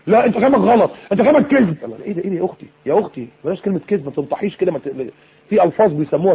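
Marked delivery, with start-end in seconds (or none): 0.1-1.8 higher, forceful accusing retort; scowling, quarrelsome. Acted. Scowling wife telling husband he is wrong, quarrelsome. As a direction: contradict bluntly with a raised voice. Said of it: "قاعدة بقى مكشرة".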